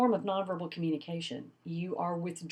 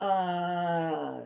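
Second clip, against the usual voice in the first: drawn-out uh; mock filler sound; filled pause demo. Shown as a demonstration. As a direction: slide in with a long uh. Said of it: "Don't slide in with a big old".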